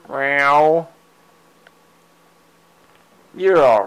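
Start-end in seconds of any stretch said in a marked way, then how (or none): 0.1-0.9 a light meow at the cat; mock meow. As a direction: meow back casually. Meowing at her cat.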